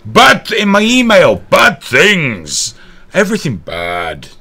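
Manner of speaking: high, loud exasperated outburst; exasperated rant. Real, not acted. Host lamenting his grim inbox, loud exasperated outburst.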